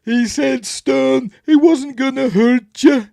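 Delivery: very high, loud cartoon wail; Robot Chicken parody. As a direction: shriek it as an outraged parody trooper. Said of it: "if you've watched Robot Chicken".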